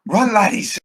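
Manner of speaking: high, loud rallying call; urgent shouted order in character; captain calling out. Acted. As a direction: holler it to your fleeing crew. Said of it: "he's like".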